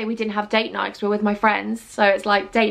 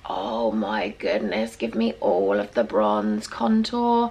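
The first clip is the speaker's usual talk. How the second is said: much lower, slow, softer diva voice; deep diva voice; 14 semitones lower, 4 dB quieter, slower than usual. Acted. Demanding more bronzer, low slow diva voice. Admiring contour, voice drops.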